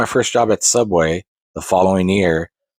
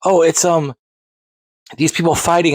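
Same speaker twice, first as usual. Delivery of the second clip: higher, fumbling parent trying to describe the game; confused parent; 9 semitones higher than usual. Retold or imitated. Parent describing the game to the clerk, higher and unsure. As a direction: play a parent who can't remember what the game is. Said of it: "What's the character?".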